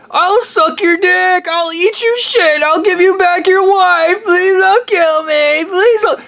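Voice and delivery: high, slow whimpering plea; begging Homelander. Retold or imitated. Homelander begging for mercy in memes, high whimpering mock. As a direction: grovel and beg for your life, mockingly. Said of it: "How the fuck does Homelander go".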